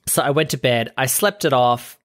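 usual speaking voice

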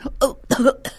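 high mock coughing fit; acted choking cough. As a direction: splutter and hack as if on smoke. Acts out choking on smoke.

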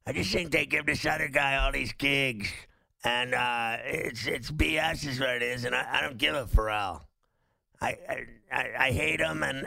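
mumbled, fat-lipped fighter impression; Nate Diaz. Host mocking a fighter punched in the mouth, slurred mumble. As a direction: talk as if through missing teeth and swollen lips. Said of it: "he talks like this".

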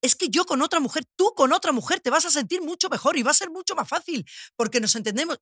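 insistent friend. Retold or imitated. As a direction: pitch it up, swinging melodically, as a persuading friend. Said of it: "una amiga que siempre me dice".

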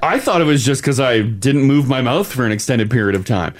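usual speaking voice

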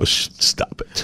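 hushed half-whisper. Real, not acted. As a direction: drop to a whisper. Embarrassed hushed aside.

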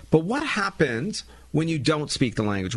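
ordinary delivery

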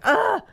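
a sharp, high, fed-up "ugh"; exhausted groan. Retold or imitated. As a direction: let out a worn-out groan. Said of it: "I was like".

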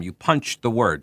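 the word punch hit with emphasis, then falling; demonstrating emphasis on "punch"; stress on punch. Shown as a demonstration. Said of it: "just like I just did there with the word punch".

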